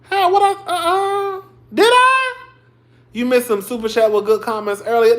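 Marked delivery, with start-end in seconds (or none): 0.0-2.2 surprised squeal. Real, not acted. Reacting to a super chat comment.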